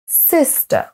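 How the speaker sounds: "Sister" as high SIS, falling -ter; first-syllable stress. Shown as a demonstration. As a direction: punch SIS in "Sister", drop the ending. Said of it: "On accentue sur la première".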